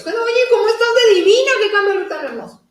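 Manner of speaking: high, fast gushing friend; squealing compliment, fast and high; gushing praise. Retold or imitated.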